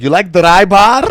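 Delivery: put-on loud, high, drawn-out Arabic-accented question; thick Arabic accent. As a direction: ask it big in a mock Arabic accent. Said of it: "with a thick Arabic accent".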